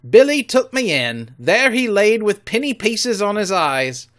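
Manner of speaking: loud rough-voiced pirate; pirate Morgan. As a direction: grumble like an old shipmate. Morgan recalls Flint.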